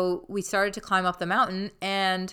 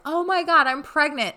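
pretend blurt; 8 semitones higher, 4 dB louder than usual. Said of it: "I wanted to be like".

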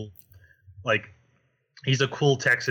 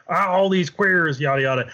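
mock bad Southerner; 5 semitones higher, 4 dB louder than usual. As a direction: ham up an ignorant Southern bigot. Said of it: "the bad Southerner".

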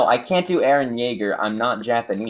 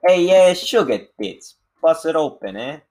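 hammed-up Italian accent; 7 semitones higher, 5 dB louder than usual. Asked by a friend to add the accent, mock Italian. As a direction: ham it up like a cartoon Italian guy. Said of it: "Italian accent with it".

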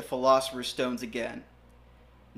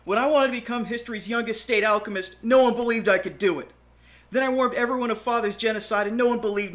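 high, fast shout over the wind; 5 semitones higher, 4 dB louder than usual. Acted. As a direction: yell over roaring wind. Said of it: "Edward shouted over the rushing wind".

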